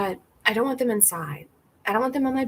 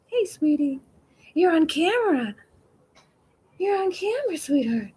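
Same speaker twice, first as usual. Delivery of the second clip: high, louder pet-talk; cooing at a cat; 8 semitones higher, 5 dB louder than usual. Real, not acted. Speaking to the stray cat on camera, high cooing.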